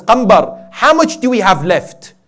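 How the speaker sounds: high, loud call to a servant. Retold or imitated. Imam asks Qambar what money is left, calling out. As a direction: voice the Imam's urgent summons in preaching style.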